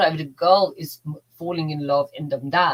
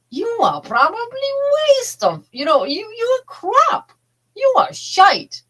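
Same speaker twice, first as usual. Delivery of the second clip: inner critic; 3 semitones higher, 4 dB louder than usual. Retold or imitated.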